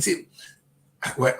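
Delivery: ordinary talk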